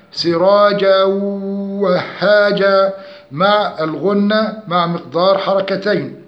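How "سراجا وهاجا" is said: سراجا وهاجا with n merged into a hummed w; chanted سراجا وهاجا with ghunna; nasal merging. Shown as a demonstration. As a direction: hold a nasal glide between سراجا and وهاجا. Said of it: "ناقص بغنة".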